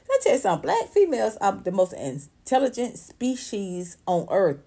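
ordinary talk

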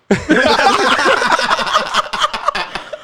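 very high cackling laughter; shrieking laugh. Real, not acted. Big laugh at the bowl question.

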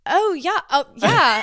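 high, loud British-tinged reply; accidental British accent. Retold or imitated. Replying to British lady, catching the British accent. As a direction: slip into a mock British accent. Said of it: "I didn't stick to it 'cause I caught it".

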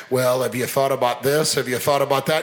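low, doubting killjoy; mock naysayer, pitched low. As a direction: drag it down like a wet blanket. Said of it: "they're just like".

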